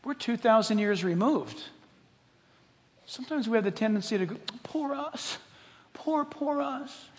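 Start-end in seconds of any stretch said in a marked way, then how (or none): 4.6-7.1 slow, soft mock whimper of self-pity. Pastor mocking believers with a slow, soft, self-pitying whine. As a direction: whine it like a sorry victim.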